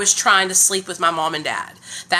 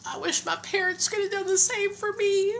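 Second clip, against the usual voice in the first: real tears welling up as she speaks; emotional, near tears; 11 semitones higher, 4 dB quieter than usual. Real, not acted. Mother on livestream wishing her parents had helped, tearful. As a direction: voice rising high and soft, close to crying. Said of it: "I'm emotional".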